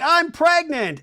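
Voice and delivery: high, loud proclamation; mock excited reveal; imitating big announcement. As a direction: announce it to a screaming crowd.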